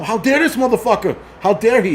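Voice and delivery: indignant outburst, pitched high; mock outrage. Man imagining waking up still mad at the rider, indignant. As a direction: wake up outraged, voice lifted.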